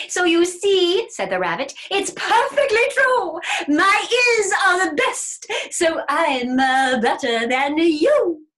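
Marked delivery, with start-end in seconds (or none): 0.1-1.1 high, loud, drawn-out crowing; triumphant rabbit. Acted. Rabbit having won the hearing test, triumphant. Rabbit crows over bear. 1.9-8.5 high, loud crowing, slowing at the end; triumphant rabbit. Acted. Rabbit bragging his ears are best, triumphant. Rabbit crows over bear.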